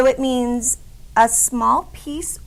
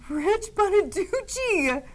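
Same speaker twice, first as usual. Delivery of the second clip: mock scolding; 8 semitones higher, much slower than usual. Real, not acted. Scolding by full name.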